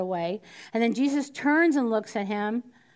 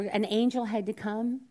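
normal talking voice

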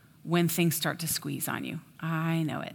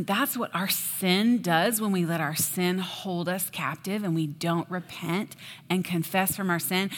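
ordinary talk

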